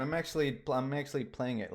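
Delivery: ordinary delivery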